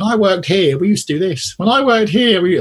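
a high nasal-free sing-song brag, mocked; mocking smug newcomer. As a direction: lampoon the colleague who keeps bragging about his old job. Mocking know-it-all new colleague.

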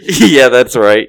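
can't stop laughing. Real, not acted. Cracking up after mocking a friend, slow laughing.

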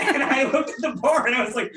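can barely get it out for laughing. Real, not acted. Telling friends the cube story, laughing hard.

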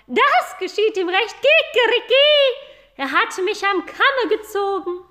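high, loud gloating rooster voice; crowing proud rooster. Acted. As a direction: crow with smug triumph. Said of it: "krähte der Hahn".

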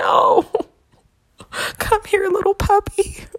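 high cooing voice with laughter; tender God voice. Retold or imitated. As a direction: coo at a little puppy. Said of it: "And he was like".

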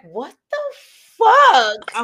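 mimicking his baffled reaction; imitated shock. Retelling her man's reaction, imitated shock. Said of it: "I know he was like".

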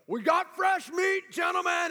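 slow, high, loud taunting call to the room; a big senior's mocking shout across the wrestling room; gloating bully shout. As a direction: announce the new victim to your buddies with relish. Big wrestler announcing his victim.

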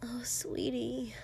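slow, very soft whisper; tender mutter. A narrator's reading. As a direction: murmur it slowly in sympathy. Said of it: "She muttered".

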